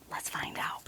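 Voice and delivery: hushed suspenseful whisper. Real, not acted. Teacher whispering suspense to the children. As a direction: breathe it like a secret. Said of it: "teasing whisper to children".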